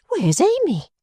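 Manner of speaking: put-on high, startled young lady's voice; alarmed high question. A narrator's reading. Fanny realising Amy is missing, asks high and alarmed. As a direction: call out in sudden alarm. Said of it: "cried Miss Fanny all at once".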